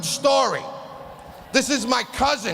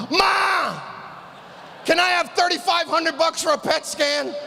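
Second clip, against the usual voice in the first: calling out to mom; 5 semitones higher than usual. Pretend. Son calling to his mother for scan money, shouting. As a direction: holler across the house for mom.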